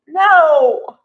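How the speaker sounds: loud, drawn-out protest; exasperated no. Acted. Viewer reacting to a soap proposal, exasperated.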